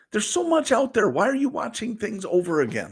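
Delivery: high, amazed teasing question; incredulous. Real, not acted. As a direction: sound baffled and teasing. Teasing disbelief at rewatching.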